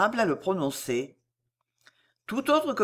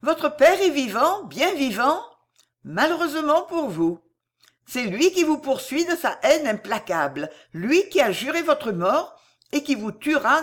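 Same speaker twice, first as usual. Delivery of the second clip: higher-pitched, relentless warning; coldly implacable; 6 semitones higher, 3 dB louder than usual. A narrator's reading. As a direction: drive each point home without pity. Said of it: "Froidement implacable, sous ses airs doucereux".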